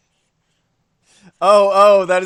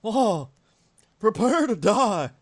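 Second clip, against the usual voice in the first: higher-pitched robot cartoon-dad threat; Mecha Hank Hill; 5 semitones higher, slower than usual. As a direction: play a mecha sitcom dad issuing a threat. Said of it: "Also, here's Mecha Hank Hill".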